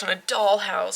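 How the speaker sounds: ordinary talk, nothing marked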